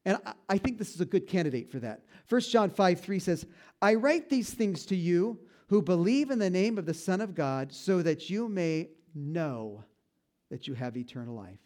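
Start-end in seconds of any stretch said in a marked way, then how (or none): none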